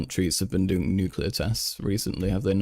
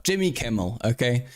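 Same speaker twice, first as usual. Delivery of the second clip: ordinary delivery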